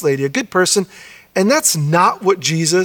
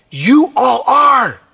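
"You" hit much higher, slow and strong, then falling; slow, high proclamation on "You"; emphatic You all are; 8 semitones higher, 4 dB louder, much slower than usual. Shown as a demonstration. Pastor front-loading Jesus' words for the congregation, stressing You. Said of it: "He front loads it in his statement to emphasize".

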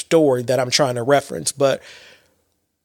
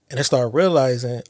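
normal talking voice

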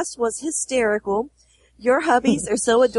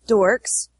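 normal talking voice

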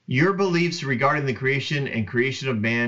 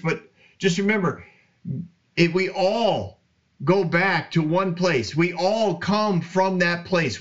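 preaching; 5 semitones higher than usual. Acted. Podcast host preaching to listeners about unity, fervent sermon voice. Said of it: "that's all the preaching for today".

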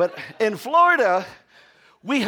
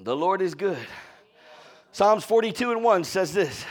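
ordinary talk

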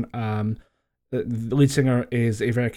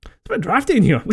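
puzzled; 9 semitones higher, 4 dB louder, faster than usual. Retold or imitated. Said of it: "I was like".

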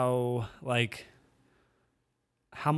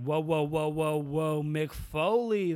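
a light hint of The Rock bursting in; drawn-out whoa, hyped; Rock impression; 2 semitones higher, slower than usual. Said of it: "he came in and it was like".

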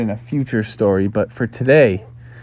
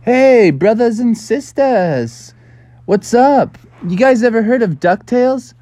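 hollering out; 10 semitones higher, 3 dB louder than usual. Acted. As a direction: call out to the whole pond. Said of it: "he hollered out".

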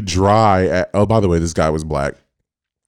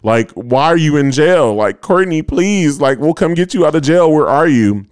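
retold pleading; 7 semitones higher than usual. Retold drunken pleading with friend.

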